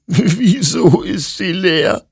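high, slow, tearful wail; sobbing. Acted. Hector finds his rifle empty, sobbing loudly. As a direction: sob the question out in despair. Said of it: "schluchzte Hector".

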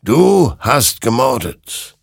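loud, slow, weighty verdict; accusing creature. A narrator's reading. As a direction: pronounce the charge slowly and loudly. (langsam und laut anklagend)

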